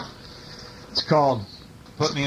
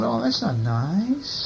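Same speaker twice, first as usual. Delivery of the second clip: slow, high tut-tut; disapproving; 6 semitones higher, much slower than usual. Acted. Reader to child about the zoo, slow disapproving. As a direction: draw it out like a gentle scolding.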